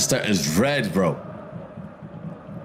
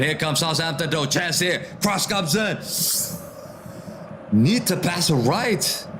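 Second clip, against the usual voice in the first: higher, rising match commentary as the chance builds; excited play-by-play; 6 semitones higher than usual. Real, not acted. Streamer calling a Southampton chance for viewers, excited. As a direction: call the attack as it unfolds.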